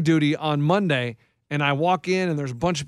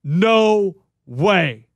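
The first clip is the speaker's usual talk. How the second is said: retold exclamation; 4 dB louder than usual. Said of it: "turns around and goes".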